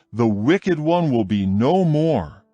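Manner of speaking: usual speaking voice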